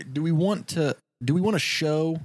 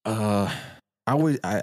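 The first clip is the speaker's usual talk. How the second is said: normal talking voice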